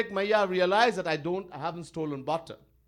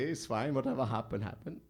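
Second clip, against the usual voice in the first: normal talking voice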